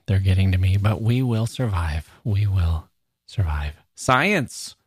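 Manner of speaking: ordinary delivery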